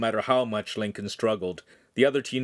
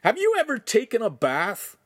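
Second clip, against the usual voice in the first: put-on teenage boy voice; 13 semitones higher, 4 dB louder than usual. Teen mocks master.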